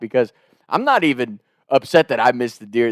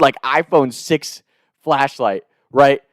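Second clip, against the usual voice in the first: ordinary talk, nothing marked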